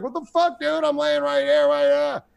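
high, loud indignant yell; man on the ground yelling at the one who stepped on him; mock angry yell. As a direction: yell at the guy who stood on you. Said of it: "the guy's like".

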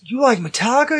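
high-pitched incredulous question; retold surprise. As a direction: imitate a kid amazed by your taste. Retold classmate's astonished question.

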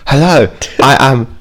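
tentative high-pitched Scottish impression; Scottish accent. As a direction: have a go at a Scottish lilt. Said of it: "I'm trying to do a Scottish accent".

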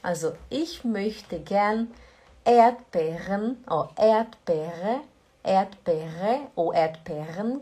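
very slow, each word spaced; slow careful German; slow model sentence. Shown as a demonstration. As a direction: spell it out slowly for the learner. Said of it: "slow teaching example sentence".

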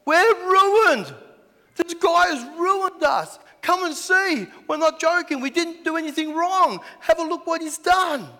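panicked pig farmers. Retold or imitated. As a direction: wail it like ruined farmers begging people to look. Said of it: "It was like".

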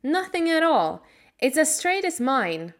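put-on high, childish insistence; crossly spoken. A narrator's reading. Mary reassuring Colin about his back, crossly. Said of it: "those crossly spoken, childish words".

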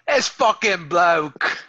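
slow, hammed-up British bloke accent; mock British accent. Cohost teasing a British reviewer in a mock British accent. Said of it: "Now I'm Australian".

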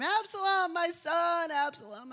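loud, high anguished cry of the name. Retold or imitated. David mourning his son Absalom, loud high grief-stricken cry. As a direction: wail the son's name in grief. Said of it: "He's crying".

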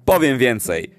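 radio sermon. A narrator's reading. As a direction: preach to the radio audience. DJ's on-air sermon.

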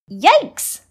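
high loud yikes; startled yelp. Acted. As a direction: jump with fright on it. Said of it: "the ballet girl said".

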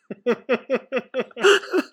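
long, high-pitched burst of laughter; helpless high giggling; high laughing fit. Real, not acted. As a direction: crack up at your own punchline. Laughs after his baldy punchline.

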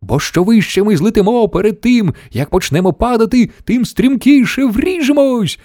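high, urgent explanation over the din; excited high-pitched call; exclaiming. A narrator's reading. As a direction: cry it out, climbing to a stretched last word. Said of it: "вигукнув містер Вонка".

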